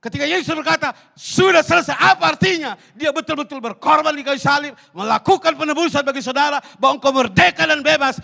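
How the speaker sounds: loud, high-pitched impassioned preaching; full-force preaching. Acted. Preacher rousing the congregation, loud and impassioned.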